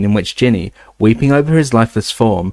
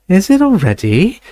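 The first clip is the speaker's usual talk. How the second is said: vague; 9 semitones higher than usual. A narrator's reading. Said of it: "said Luna vaguely".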